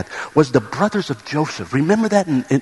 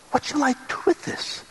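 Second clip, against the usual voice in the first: helpless whisper; 10 semitones higher, 6 dB quieter than usual. Acted. Parent at wit's end, giving up.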